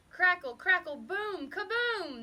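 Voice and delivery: mock thunder bellow, high and loud. A narrator's reading. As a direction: crackle then boom like a storm. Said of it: "the thunder bellowed".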